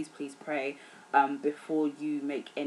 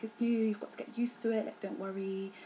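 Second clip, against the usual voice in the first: soft, higher-pitched mimicry of lecturers soothing students; higher, quieter put-on voice, placating; reassuring lecturers; 5 semitones higher, 5 dB quieter than usual. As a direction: imitate a lecturer lightly brushing off worries. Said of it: "our lecturers were like".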